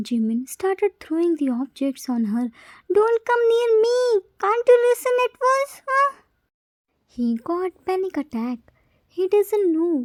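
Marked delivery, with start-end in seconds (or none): 3.0-6.1 high, loud panicked yelling; frantic yell. A narrator's reading. Scared child throwing things, yelling at the nurse. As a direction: scream at her to keep away.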